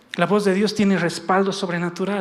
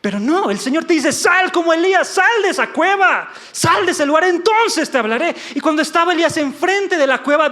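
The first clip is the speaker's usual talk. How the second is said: high, loud preaching with shouted commands; forceful preaching; 7 semitones higher, 5 dB louder than usual. Real, not acted. Preacher voicing God ordering Elías out of the cave, forceful preaching. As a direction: drive it urgently to an emphatic stop.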